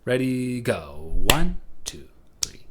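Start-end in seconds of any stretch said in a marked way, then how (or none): 1.1-2.6 One raised and louder, two three lighter; accent One in the count; counting a triple beat for the class. Shown as a demonstration. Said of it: "let's practice that twice in a row".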